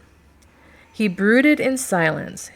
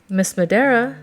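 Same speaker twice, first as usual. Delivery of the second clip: louder query with lifted pitch; 5 semitones higher, 5 dB louder than usual. A narrator's reading. Duke holds out his hand to Miss Madera, querying. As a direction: prompt her with a raised, questioning tone. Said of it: "the Duke queried".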